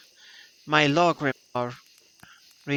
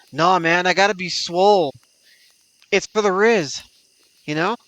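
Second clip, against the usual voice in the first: loud bro voice; 4 semitones higher, 6 dB louder than usual. Acted. Bragging about getting swole.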